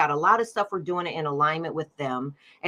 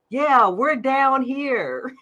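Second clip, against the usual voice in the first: slow, higher, lightly voiced distant man; faint male voice far away; 6 semitones higher, much slower than usual. A narrator's reading. Man's voice answering from far down, lightly voiced. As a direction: call up lazily from far below. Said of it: "a very faint male voice far, far away".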